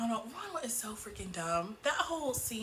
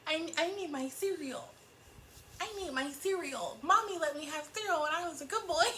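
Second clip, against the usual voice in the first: high-pitched mock little boy; imitating a childish, petulant man; 6 semitones higher than usual. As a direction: whine it like an immature kid demanding a treat. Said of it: "how he sounds petulant and immature".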